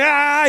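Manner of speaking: a loud, high tearful cry of release; sudden loud, high emotional outcry while crying. Retold or imitated. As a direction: let out a loud, high weeping "ah". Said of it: "empecé a llorar y dije".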